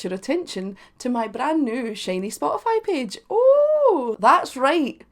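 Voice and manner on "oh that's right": high, loud gleeful "Oh"; excited exclamation. Acted. Promoting her Spotify page to viewers, loud and gleeful. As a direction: burst out with glee at the news.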